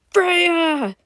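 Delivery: high-pitched cry of her name; gurgled shout. A narrator's reading. Gurgled voice calling to Freya from above, urgent high shout. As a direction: shout as if through water. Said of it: "a gurgled voice shouted from above".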